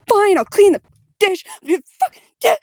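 high, loud, enraged yell at the dish; furious yelling. Retold or imitated. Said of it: "I would be like".

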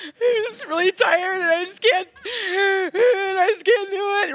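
high, breaking, half-laughing sob of exhaustion; tearful blithering. Retold or imitated. Exhausted new mother crying to God at night, high tearful blither. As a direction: imitate herself falling apart in tears, laughing through it. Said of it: "you're, like, blithering on".

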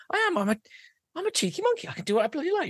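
high-pitched, brazen insistence; defiant cheeky monkey. Acted. Cheeky monkey arguing with a passenger, high defiant voice. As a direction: defy them with a squeaky boast.

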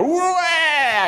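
high hammed-up crying wah; mock wail. Imitating a crying reaction, high mock wail. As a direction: wail like a baby. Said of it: "And he goes".

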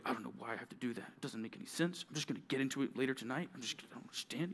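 low, quick grumble under the breath; grumbling. Acted. Kid told to make the bed, grumbling quietly. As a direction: grumble softly to yourself while walking away. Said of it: "you grumble".

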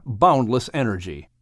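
ordinary delivery

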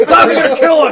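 loud, high hammed-up wail; mock panic. Parodying overreacting news about clowns, mock panic. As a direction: shout it like a hysterical doomsayer.

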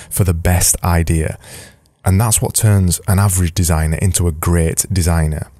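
usual speaking voice

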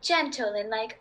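easy, conversational British accent; fluent mock-British English. Shown as a demonstration. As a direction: chat away in a put-on British accent. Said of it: "propose a British accent for me".

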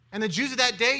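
normal talking voice

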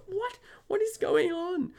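very high, incredulous outburst; squeaked disbelief, drawn out at the end; bewildered. Retold or imitated. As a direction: act out baffled exasperation. Teller retells his bewilderment.

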